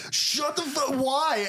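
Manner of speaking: high, exasperated mock yell; mock outrage. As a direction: pitch it up in comic outrage. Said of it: "Patton's so fucking mad".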